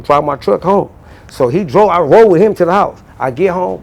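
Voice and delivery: ordinary talk, nothing marked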